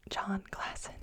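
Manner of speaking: ordinary delivery, nothing marked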